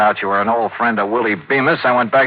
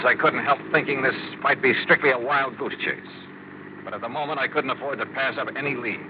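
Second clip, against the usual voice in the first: hushed, softer suspense narration; suspenseful narration; 7 dB quieter than usual. Acted. The detective narrating as he creeps into the dark lodge in a hushed, tense voice. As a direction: draw the listener into the dark room.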